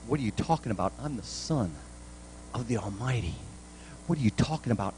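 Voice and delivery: soft, disbelieving echo of the question; quietly astonished Jesus; incredulous. Retold or imitated. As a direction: hand the words back as if they can't be meant. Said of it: "So I think Jesus really incredulously".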